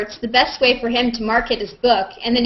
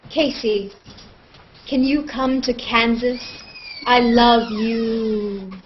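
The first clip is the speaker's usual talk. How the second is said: mock creepy admirer, drawn-out love line; creepy reading; much slower than usual. Host reading a fan comment, a touch of creepy. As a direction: read the fan comment like a lightly creepy stalker. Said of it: "Want me to be creepy?".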